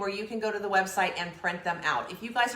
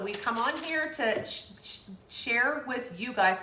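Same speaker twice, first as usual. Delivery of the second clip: stumbling over words; 2 semitones higher than usual. Real, not acted. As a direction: trip on the word share, sh- sh- share. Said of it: "God, that was hard to say".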